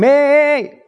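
very high, loud hey hey hey; hammed-up churchy hello; mock eager greeting. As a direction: burst in with over-the-top cheer.

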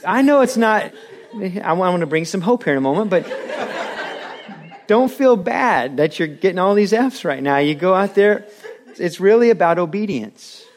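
chuckling through the words; amused, words broken by laughter; laughing. Real, not acted. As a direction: laugh while making the point. Preacher laughing through his point.

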